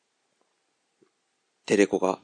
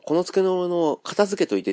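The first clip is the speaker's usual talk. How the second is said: この机のものを片付けといて said much higher as the right phrasing; correct-usage example; 8 semitones higher than usual. Shown as a demonstration. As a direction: quote the proper way, voice lifted. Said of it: "って言うんですよ". (正しい言い方として高い声で)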